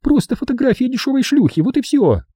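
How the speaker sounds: high falsetto contempt; high-pitched disdain; scornful snort. A narrator's reading. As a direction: dismiss it in a high woman's voice. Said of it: "фыркнула она".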